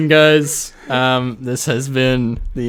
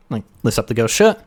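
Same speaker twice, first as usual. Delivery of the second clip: ordinary delivery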